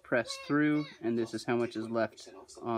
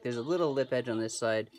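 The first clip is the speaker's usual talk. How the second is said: usual speaking voice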